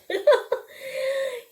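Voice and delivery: high burst of real laughter; laughing at own slip. Real, not acted. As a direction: crack up at the mistake.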